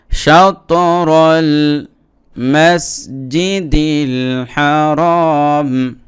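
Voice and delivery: drawn-out melodic reading; slow recitation demo. Shown as a demonstration. Teacher recites the corrected phrase for the viewers, slow and melodic. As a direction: stretch each word slowly as in tilawah.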